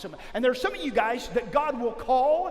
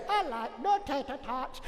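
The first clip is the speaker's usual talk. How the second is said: sad lunch lady; 5 dB quieter than usual. Retold or imitated. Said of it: "it was like she was sad".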